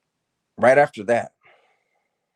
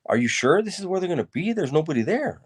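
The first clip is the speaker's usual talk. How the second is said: much higher-pitched voice imitating a questioning mother; retold motherly doubt, pitched way up; doubtful mom; 10 semitones higher than usual. Mom asking son if anyone is coming, doubtful.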